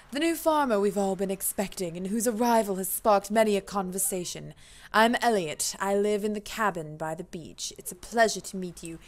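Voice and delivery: lightly put-on posh gentleman's accent. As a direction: introduce yourself in a refined posh accent. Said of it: "We're gonna give him a posh accent".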